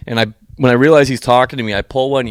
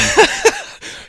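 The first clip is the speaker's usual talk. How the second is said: very high squealing laugh. Real, not acted. Laughing at friend.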